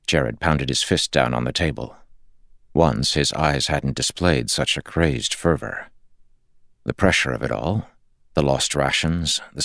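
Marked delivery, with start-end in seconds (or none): none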